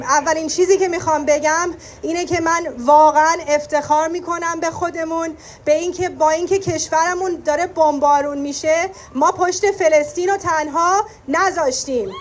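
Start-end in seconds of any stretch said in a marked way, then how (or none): none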